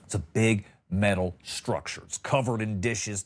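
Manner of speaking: ordinary talk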